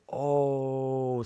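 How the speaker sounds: long drawn-out high "oh"; acted-out feigned casual reaction; playing it off. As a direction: cover the shock with a stretched "oh". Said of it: "had to play it off".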